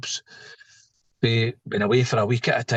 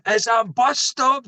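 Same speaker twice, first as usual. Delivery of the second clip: high, drawn-out mock yell; retold shout; 9 semitones higher, slower than usual. Examiner yelling at a learner car in the bus stop, retold shout. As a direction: call it out slowly, pitched well up. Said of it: "he's shouting".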